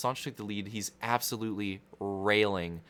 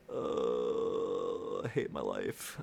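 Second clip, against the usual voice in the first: mock despair; 13 semitones lower, 3 dB quieter, much slower than usual. Real, not acted. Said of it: "despair at own ramble".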